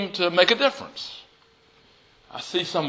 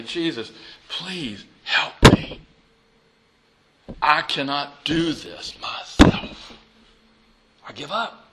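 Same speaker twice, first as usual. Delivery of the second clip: humble prayer. Acted. Preacher models kneeling prayer to Jesus, slow and humble. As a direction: pray it slowly, surrendering.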